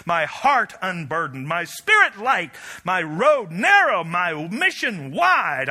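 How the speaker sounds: preached cry. Acted. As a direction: hammer each phrase out. Preaching climax.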